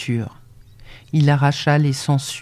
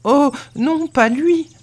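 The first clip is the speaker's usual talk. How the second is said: high-pitched, pleading denial; distressed cry; 10 semitones higher, 3 dB louder, slower than usual. A narrator's reading. Servant girl denying the farmer's guess, crying out. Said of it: "Elle s'écria".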